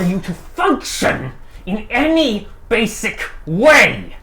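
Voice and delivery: rising to a furious shout; building anger. Acted. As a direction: build from tense to a roar. GM voices rage building.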